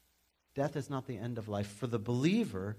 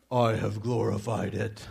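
slow, booming, sinister God; an attempt at God that comes out evil; loud, drawn-out voice from heaven; 3 semitones lower, 5 dB louder, slower than usual. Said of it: "hard to do the God voice".

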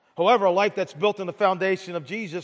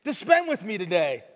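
delighted Jesus; 7 semitones higher than usual. Retold or imitated. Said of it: "And Jesus says".

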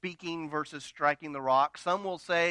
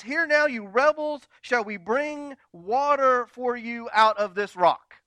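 higher, louder angry outburst; snapping in anger; 6 semitones higher, 6 dB louder than usual. Retold or imitated. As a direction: snap at the crowd like Moses losing it. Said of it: "Moses snaps".

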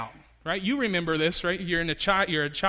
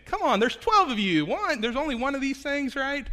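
incredulous; 10 semitones higher, 4 dB louder than usual. Retold or imitated. Preacher's own incredulous thought.

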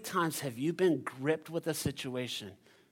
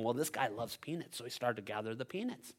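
ordinary talk, nothing marked

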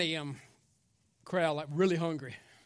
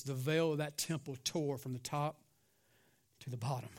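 low, soft, reverent sermon voice; solemn preaching; 3 semitones lower, 8 dB quieter than usual. Real, not acted. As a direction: bring it down to a grave hush.

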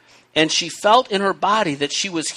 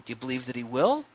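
soft, gentle, higher questions; gentle asking; 3 semitones higher, 10 dB quieter than usual. Retold or imitated. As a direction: ask it softly and kindly. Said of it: "I think it's okay to ask".

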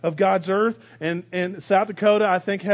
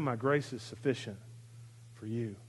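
soft, low and intimate; hushed voice of God; 8 semitones lower, 12 dB quieter than usual. Acted. Pastor voicing Jesus speaking grace to Pol Pot, hushed. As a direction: say it gently, almost to yourself. God's words to an evil man.

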